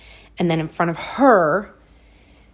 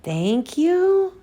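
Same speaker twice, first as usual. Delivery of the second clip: put-on high, small child's 'thank you'; a confused toddler mumbling thanks; little girl voice; 10 semitones higher than usual.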